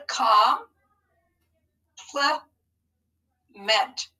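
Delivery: Com, Pl, Ment as separate beats with long pauses; syllable by syllable. Shown as a demonstration. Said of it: "break that down into syllables".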